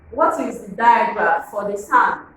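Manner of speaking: normal talking voice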